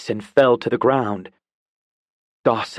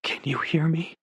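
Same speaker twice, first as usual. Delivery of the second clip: softer, close to her ear; 5 semitones higher, 5 dB quieter than usual. Acted. Dawson leans to London's ear, speaking softly. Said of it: "his words were next to her ear".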